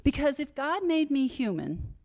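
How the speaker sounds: normal talking voice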